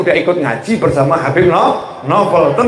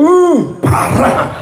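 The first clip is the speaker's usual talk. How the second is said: high, stretched "uh" of shock; appalled reaction; 8 semitones higher, much slower than usual. Acted. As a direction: react as a scandalized crowd, slow and high. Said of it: "kita ngomong".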